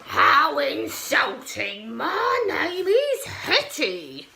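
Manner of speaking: slow, loud growling monster voice; insulted monster growls at the boy; angry monster. Acted. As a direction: growl it out offended. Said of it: "glared down with the angriest growl".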